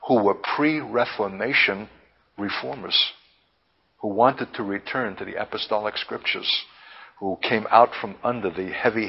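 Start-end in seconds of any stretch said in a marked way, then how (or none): none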